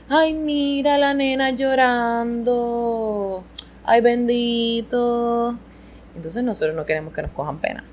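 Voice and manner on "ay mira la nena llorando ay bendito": drawn-out, higher pitying coo; exaggerated "poor thing" voice; mock pity. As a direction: cluck over a crying child, slow and sing-song. Mimics adults pitying a crying girl.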